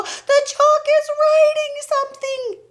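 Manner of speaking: very high, shrill, loud alarm; screaming. A narrator's reading. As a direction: shriek it at the whole classroom. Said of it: "screamed Nigel".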